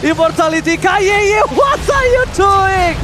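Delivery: loud, high, drawn-out hype calls; hype caster shout. Acted.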